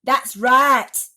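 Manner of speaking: declaring. A narrator's reading. Aunt backs her sister against the boy, declaring. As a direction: declare it firmly. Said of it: "Aunt Sponge declared".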